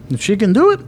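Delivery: high, hopeful average punter; eager gambler voice. Retold or imitated. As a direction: voice the eager crowd rising high. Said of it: "they will say".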